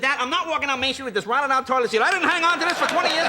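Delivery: comic high-pitched protest; protesting son. Retold or imitated. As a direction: argue back at your father. Son protests to his father.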